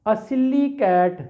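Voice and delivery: slow, deliberate reading of the line; slow classroom recitation of the sentence. Shown as a demonstration. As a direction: read it out word by word. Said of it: "ज़रा गौर करें".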